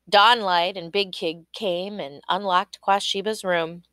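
plain reading voice

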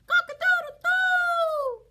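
high, loud crowing; piercing wake-up cock-a-doodle-doo; rooster crow. Acted. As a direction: crow it out like a rooster, last note held long. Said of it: "wakes up all the animals with the cock-a-doodle-doo".

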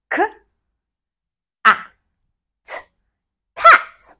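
each sound said apart, then the word; segmented blending. Shown as a demonstration. Teacher showing kids how to blend, sound by sound. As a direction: pause between K, ah, mm, then say can. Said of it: "like this".

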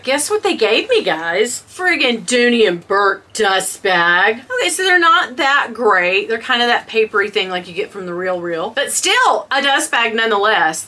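usual speaking voice

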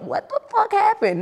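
mock confused drunk. Mimics a confused hungover drunk.

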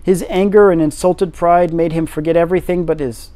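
ordinary delivery, nothing marked

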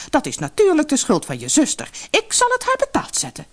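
high, outraged accusation; indignant, vengeful mother. A narrator's reading. Mother blaming the younger sister, indignant. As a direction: blame her and threaten revenge, pitch climbing.

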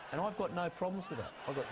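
normal talking voice

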